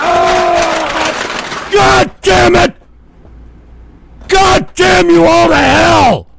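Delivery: enraged shouted damnation; furious cursing. Real, not acted. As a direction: bellow the curse in rage. Host cursing the prank clip.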